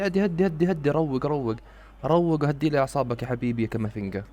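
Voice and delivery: ordinary delivery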